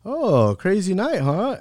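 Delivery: high, loud, teasing nurse voice; imitated nurse. Nurse greeting patient waking in hospital, imitated nurse. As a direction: put on a knowing nurse, pitched well up. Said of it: "And the nurse is like".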